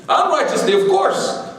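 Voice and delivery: high, sing-song "Unrighteously, of course" with a laugh behind it; playful concession to a listener; mock correction. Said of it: "Look at the brother, he's mad at us".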